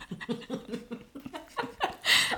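soft unvoiced laugh through a full mouth; breathy muffled laugh. Real, not acted. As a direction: laugh quietly with food in mouth.

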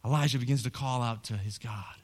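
normal talking voice

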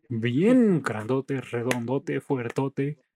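put-on slow, drawn-out northern Mexican accent. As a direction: stretch the words in a loud northern Mexican accent. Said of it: "Ya te acabo de hablar como norteño".

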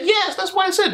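high-pitched mimicked protest; insistent retort. Woman insisting to her interviewer, mimicked high retort.

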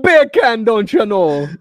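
put-on loud, high, laughing joke Jamaican accent; mock Jamaican accent. Joining the joke about failed accents, mock Jamaican accent. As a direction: shout it in a hammed-up Jamaican lilt. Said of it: "turn into Jamaican accents".

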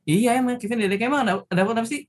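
puzzled reply. Retold or imitated. Son puzzled on phone.

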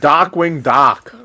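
slow Boston "Darkwing", R dropped; broad, slow Boston demo; Boston accent. Shown as a demonstration. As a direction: draw out "Darkwing Doc" like a Bostonian. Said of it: "with a Boston accent".

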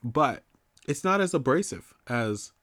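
ordinary talk

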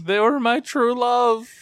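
high, loud, drawn-out lament; mock heartbroken wail. Kid losing an online friend, hammed-up wail. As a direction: wail it melodramatically, stretching the words.

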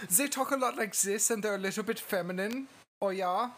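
put-on lightly lampoon the German accent as Americans hear it; mock German accent. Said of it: "it's kinda like".

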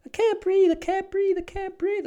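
very high, hysterical Californian squeal; mock Californian accent. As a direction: imitate a panicking Californian woman, squealing. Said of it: "Californian accent".

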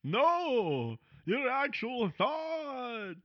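high, slow ghostly vampire; hammy undead count, drawn out. Pretend. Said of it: "you got real ghostly there".

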